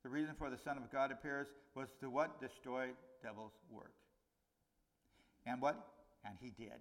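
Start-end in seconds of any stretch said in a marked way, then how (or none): none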